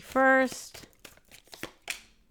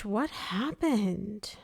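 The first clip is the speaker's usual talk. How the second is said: soft sympathy; 7 dB quieter, much slower than usual. Real, not acted. Sympathetic reaction to a sad card.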